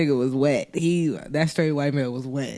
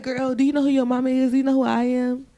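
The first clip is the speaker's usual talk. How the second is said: put-on bratty kid; 6 semitones higher than usual. Voicing [name] scolding.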